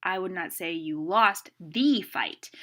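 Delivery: slow, with "lost" and "the" punched; stress on THE. Shown as a demonstration. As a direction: say "the" as a long, loud "thee". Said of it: "putting a different inflection".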